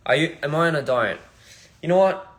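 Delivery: usual speaking voice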